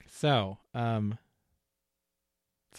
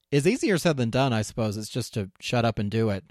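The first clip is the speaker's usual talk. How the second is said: normal talking voice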